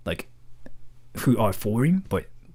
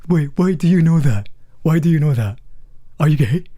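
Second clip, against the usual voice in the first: higher, louder, suddenly suspicious; suspicious viewer; 4 semitones higher, 6 dB louder than usual. Retold or imitated. Imagined viewer questions the streamer, a mock suspicious louder voice. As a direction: catch me out, half joking.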